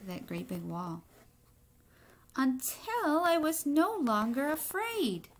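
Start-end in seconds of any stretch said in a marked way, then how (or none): 2.4-5.3 put-on expressive read-aloud to children; story reading. A narrator's reading. Teacher reading a picture book to the class, expressive narration. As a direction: tell the egg's story to the class, lightly dramatic.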